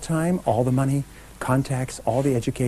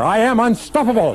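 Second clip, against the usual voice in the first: much higher, louder rallying cry; with even more conviction; 10 semitones higher, 4 dB louder than usual. Shown as a demonstration. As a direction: push it up to a triumphant rallying shout. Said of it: "Once more".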